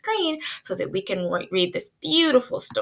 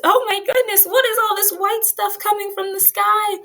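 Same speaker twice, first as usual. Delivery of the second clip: high excited gasping wonder; teacher playing herself surprised by snow; amazed surprise; 10 semitones higher than usual. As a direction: act astonished at the snow. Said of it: "[name] was so happy".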